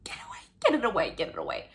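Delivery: quick, hushed mock shooing; joking whisper. Shooing away the topic after her outburst, in a hushed voice. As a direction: whisper fast as if to shoo the thought off.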